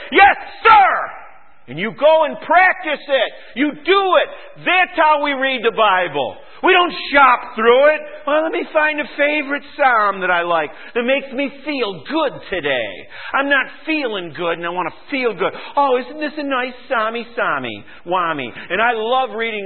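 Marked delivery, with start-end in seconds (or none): 0.1-1.1 high, forceful military "yes, sir"; obedient shout. Acted. Acting out obedient answer to God. 1.7-8.0 high, loud pulpit exhortation; full-force preaching. Real, not acted. 8.3-18.5 lightly hammed-up feel-good seeker; mildly mocking. Mocking self-indulgent Psalm readers.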